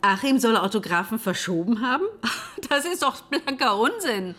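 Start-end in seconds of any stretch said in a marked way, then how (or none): 2.1-2.6 short breathy disbelieving laugh; one quick airy laugh at the absurdity; incredulous laugh. Acted. Widow laughing off the accusation, breathy disbelieving laugh.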